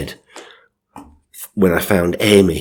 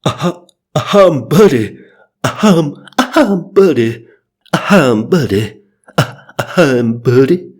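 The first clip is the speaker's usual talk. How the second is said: hiccuping singer impression, pitched up; hammed-up rock-and-roll vocal catch; 8 semitones higher, 5 dB louder than usual. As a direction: hiccup into each introduction in a silly high voice. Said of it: "The singer [name]'s here".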